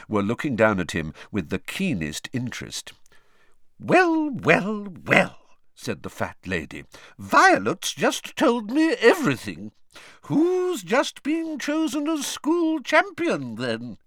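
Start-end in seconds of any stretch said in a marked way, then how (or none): 3.8-5.4 high, loud, drawn-in gossip's greeting; a knowing, high-pitched "well, well" from a busybody; gossipy portrait lady. Acted. 7.2-14.0 high, loud busybody voice; nosy painted lady, slowing on the big news; gossipy portrait lady. Acted. Gossiping portrait teases the champion.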